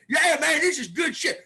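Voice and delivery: high, loud hammed-up bro; high, loud mock rockabilly bro praising his spit bottle; mock dude. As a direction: shout it like a proud dipper. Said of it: "they're like".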